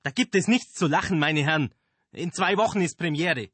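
high, loud stern reproach; scolding. Acted. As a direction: reprimand them sharply. Director scolds laughing actors.

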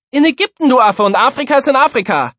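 very high, loud comeback; squabbling schoolkid, shrilly insistent; defiant bickering. A narrator's reading. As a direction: shout back the obvious answer. Pupil retorts in the squabble.

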